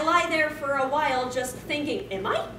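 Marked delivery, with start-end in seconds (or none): none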